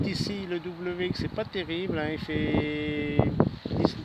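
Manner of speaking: ordinary talk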